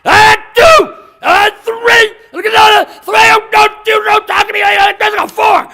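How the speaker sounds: high, full-force drill shout; barking. Acted. Trainer counting for lined-up prisoners, barked shouting. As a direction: bark orders like a brutal instructor. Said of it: "there's somebody barking".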